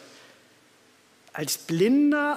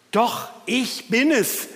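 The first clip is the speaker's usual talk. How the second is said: lightly voiced healed man; 4 semitones higher, slower than usual. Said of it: "erklärte es der Mann selbst".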